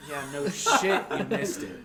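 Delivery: high, loud, laughing disbelief; laughing exasperation. Retold or imitated. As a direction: scoff at him for missing. Mocking the cop who missed.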